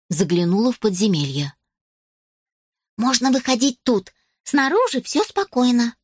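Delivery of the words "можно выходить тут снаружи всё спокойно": put-on higher elf girl voice. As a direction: report it like a cheerful elf.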